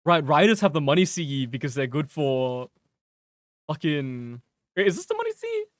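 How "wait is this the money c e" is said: sudden surprise. Real, not acted. Sudden doubt mid-sentence.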